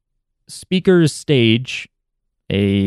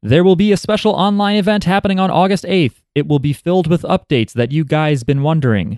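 bright, higher announcer read; announcement read; 7 semitones higher than usual. Acted. Reads out the event announcement.

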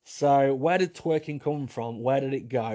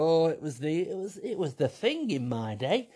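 put-on old lady voice; 2 semitones higher than usual. The pouter at eighty defends old photos in an old lady voice. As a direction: reminisce like a pensioner about her youth. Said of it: "you're gonna go".

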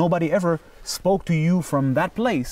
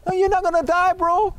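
insistent friend; 4 dB louder than usual. Retold or imitated. Friend insists he won't die.

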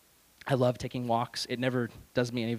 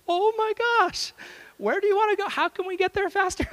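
very high, hammed-up alarm; comic panic; 4 dB louder than usual. Driver learns his passenger just left prison, comic panic. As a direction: squeal the questions in mock panic.

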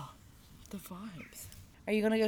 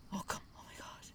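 gasped whisper of delight; excited whisper; 12 dB quieter than usual. Real, not acted. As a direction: whisper in awe at the dog. Gasps at a dog.

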